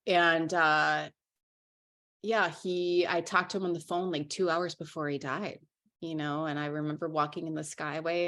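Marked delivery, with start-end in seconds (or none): none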